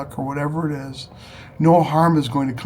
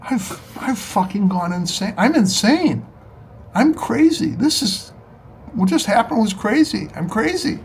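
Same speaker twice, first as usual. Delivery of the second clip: frantic disbelief; 7 semitones higher than usual. Retold or imitated. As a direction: voice jumps way up in shock at himself. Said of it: "I thought".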